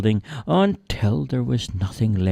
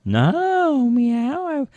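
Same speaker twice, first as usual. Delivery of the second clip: very high, squeaky kitten; high little cat voice, a bit quick. Acted. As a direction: talk like a tiny runaway kitten. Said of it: "said the kitten".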